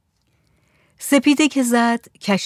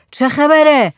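raised, louder questioning call; 6 semitones higher, 6 dB louder, slower than usual. A narrator's reading. Headman asking the rooftop lookout for news, calling out. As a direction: call up to someone at a distance.

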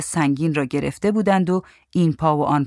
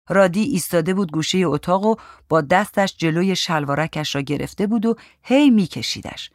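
usual speaking voice

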